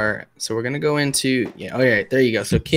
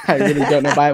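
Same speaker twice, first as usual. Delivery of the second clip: amused, laughing; faster than usual. Real, not acted. Amused at own accent slip.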